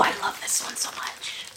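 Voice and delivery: breathless awed whisper. Real, not acted. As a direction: whisper it like you found treasure. Gasping at a sticker.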